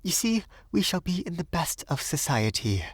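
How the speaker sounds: tentative little pleasantry in a high voice; timid. A narrator's reading. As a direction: venture it shyly, pitched high. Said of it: "she ventured timidly".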